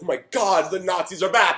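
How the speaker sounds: hammed-up alarm at the loudspeaker; playful fake terror; mock panic. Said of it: "You're, like, freaking out".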